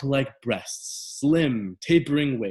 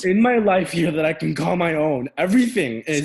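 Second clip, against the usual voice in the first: loud, frustrated protest; angry shouting; 2 semitones higher, 5 dB louder than usual. Acted. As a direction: pour out the grievance loudly. Son shouting in quarrel.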